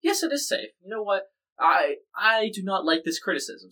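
lightly hammed-up objection, slowing at the end; Putin. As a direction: act out a defensive retort. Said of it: "And so Putin said".

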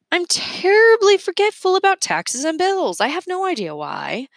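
put-on high, bright-eyed old eccentric; a daffy inventor admitting a flaw. Mergenser to the kids, high eccentric inventor voice.

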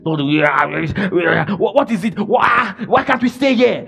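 imitating a wailing, stammering whine; complaining. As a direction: whine it out as a mock complaint to the Lord. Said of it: "as I was complaining".

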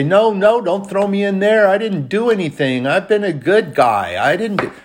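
high, loud, pleading protest; mock pleading. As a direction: beg them not to throw you in. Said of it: "Did he say".

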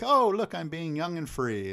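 high, loud pretend glee; sing-song show of youthful freedom; hammed-up breezy delight; mock carefree. Mocks character's carefree skipping escape.

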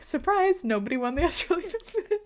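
high-pitched, playful fake reveal; mock surprise announcement. Pretends to announce an absurd surprise result.

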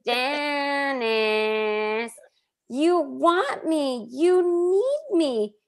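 high, very slow dramatic Janice voice; Janice impression. Host performing Janice's foghorn speech, slow and high. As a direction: stretch every word like a soap diva. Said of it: "your Janice impression".

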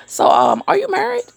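high, sly imitation of the cousin; mock cousin question. Imitating her cousin-in-law asking if she's married, high and sly. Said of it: "He's like".

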